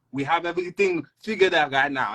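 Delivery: high, defensive Haitian robber; Haitian accent. Acted. Haitian robber answering his angry partner in a Haitian accent. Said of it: "a Jamaican and a Haitian".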